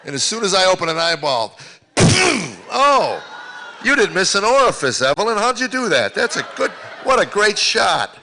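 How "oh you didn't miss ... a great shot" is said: high, loud, stretched-out mock admiration; sarcastic praise. Grandpa to granddaughter who splashed his face, mock-impressed. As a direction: loudly congratulate the culprit, higher and drawn out.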